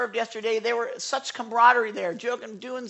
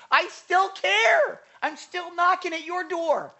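God's tender plea; 9 semitones higher than usual. Acted. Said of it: "who he said".

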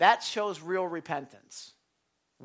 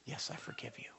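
whispered forgiveness; 7 semitones lower, 18 dB quieter than usual. Acted. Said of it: "That puts all the power in their hands".

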